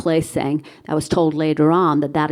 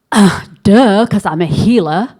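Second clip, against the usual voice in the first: annoyed; 2 semitones higher, 7 dB louder than usual. Retold or imitated. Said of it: "I'm kind of, like, annoyed".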